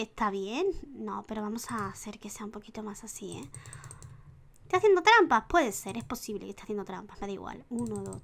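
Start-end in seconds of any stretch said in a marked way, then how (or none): 0.9-3.5 soft whisper while working; quiet whisper while tweaking; whispered aside. Real, not acted. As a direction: whisper it half to yourself. Aside while adjusting the outfit.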